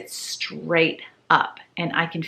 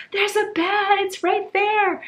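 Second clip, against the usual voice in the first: panicked; slower than usual. Retold or imitated. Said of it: "And I'm like".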